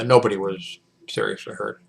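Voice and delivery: normal talking voice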